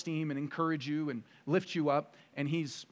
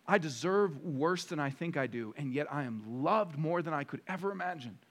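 ordinary talk

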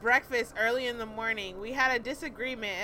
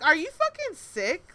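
loud, high-pitched indignant outburst; retold angry telling-off; cussing him out; 8 semitones higher, 8 dB louder than usual. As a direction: tear into him, outraged. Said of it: "I'm cussing him out".

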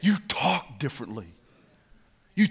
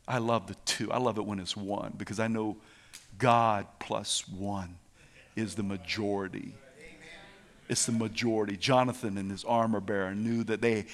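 low, soft, intimate sermon voice; hushed preaching; 6 semitones lower, 8 dB quieter than usual. Real, not acted. Preacher sharing love for the faithful two, hushed. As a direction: confide it quietly to the congregation.

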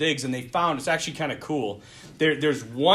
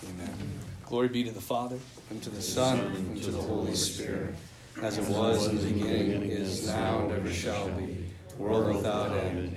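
lower, softer prayer voice; lower, hushed liturgical prayer; closing prayer; 6 semitones lower, 6 dB quieter than usual. Acted. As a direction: recite the prayer reverently.

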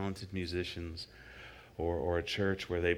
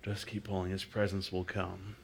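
ordinary talk

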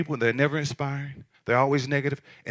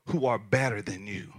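softer, dropping low on who are better than you; pointed delivery; 6 semitones lower, 8 dB quieter than usual. Shown as a demonstration. Preacher telling the congregation to mark the phrasing, pointed and soft. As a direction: lower your voice to land the point. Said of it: "listen to how I'm saying this".

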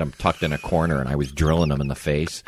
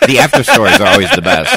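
spoken through real laughter, higher and louder; laughing; 13 dB louder than usual. Real, not acted. Host cracking up at the aftermath story, laughing. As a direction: laugh the words out.

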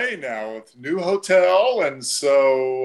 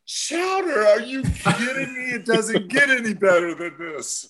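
much higher, thrilled squeal of joy; delighted disbelief; 7 semitones higher than usual. Retold or imitated. Broadcaster offered chowder on the plane, high overjoyed gushing. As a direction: gush like soup is the best gift ever. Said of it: "And David was like".

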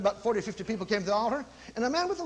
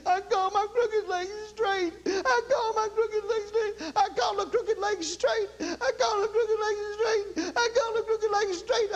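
high, slow, tearful repeating; crying the words out, half laughing; weeping; 11 semitones higher, slower than usual. Retold or imitated. Healed man weeping as he repeats his confession, high and slow. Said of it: "began to cry and weep".

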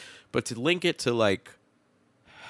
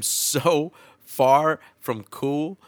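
drawn out; 6 semitones higher, 6 dB louder, much slower than usual. Real, not acted. Mock drawn-out self-deprecation.

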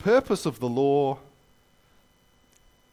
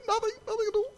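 tiny, very high, softer voice; giggling culprits; 5 dB quieter, faster than usual. Retold or imitated. Two giggling boys denying it to the cop, squeaky. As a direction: squeak out a mock-innocent denial. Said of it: "they're giggling like crazy".

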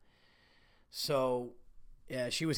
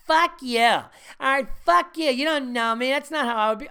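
high, loud, indignant "fuck you" retort; angry woman listener; 8 semitones higher, 6 dB louder than usual. Pretend. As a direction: fire back as an offended listener, pitched way up. Imagined women listeners snapping back.

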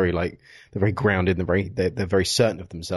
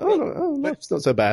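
put-on old greeter. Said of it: "And he's like".

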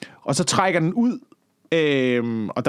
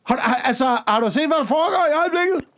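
higher-pitched mock telling-off; mock scolding, much higher; 10 semitones higher than usual. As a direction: scold them like a know-it-all, pitched up. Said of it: "Taler du til hajerne nu?". (spottende skældud mod dem, der flygter over grænsen, højt)

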